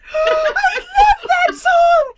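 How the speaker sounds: shrieking high with joy; squealing delight. Acted. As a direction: ecstatic high-pitched cry.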